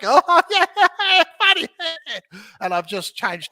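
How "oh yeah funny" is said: high, loud amused voice; mock audience reaction. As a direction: play a delighted listener. Mimics audience reacting to joke.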